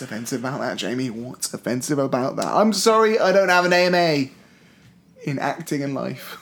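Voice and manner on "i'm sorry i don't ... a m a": usual speaking voice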